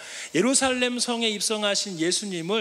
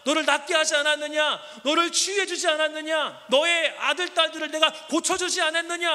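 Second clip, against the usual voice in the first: higher, pleading hurt questions; hurt reproach; 6 semitones higher than usual. Pretend. Preacher imagines Jesus reproaching the crowd, hurt. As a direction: reproach old friends with hurt. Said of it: "서운한 마음으로 그렇게 막 얘기할 것 같아요".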